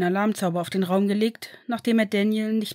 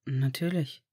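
lower, slow reassuring fatherly voice; gentle father; 6 semitones lower, 3 dB quieter, slower than usual. Acted. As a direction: promise him he is safe now. Father answers. (tiefer, ruhiger, beruhigender Vater)

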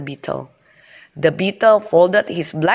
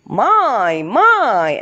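higher, lightly exclaimed wonder; a friendly bee exclaiming in mild surprise; storybook exclamation, pitched up; 5 semitones higher, 3 dB louder than usual. Acted. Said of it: "exclaimed the friendly bumblebee".

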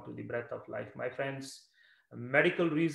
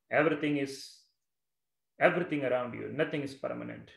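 ordinary talk, nothing marked

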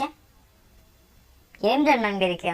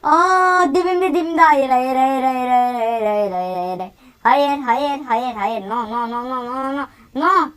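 panicked yelling; 4 dB louder, much slower than usual. Real, not acted. Gamer fleeing a monster, loud panicked pleading. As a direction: beg it away in a panic.

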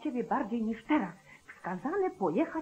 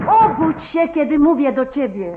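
urgent wake-up call; 3 semitones higher, 8 dB louder than usual. Acted. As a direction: call him sharply out of sleep. Wife shakes sheriff awake at night. (głośno i natarczywie, budząc męża)

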